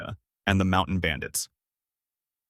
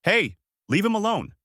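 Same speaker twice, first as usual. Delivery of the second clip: yelled; 10 semitones higher than usual. A narrator's reading. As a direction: yell to protect your friend. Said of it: "Luffy yelled out".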